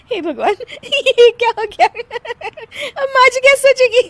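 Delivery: high, loud fretting through giggles; squeaky, laugh-choked lament; helpless laughing dismay. Acted. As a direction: worry aloud but keep cracking up. Worries while unable to stop laughing.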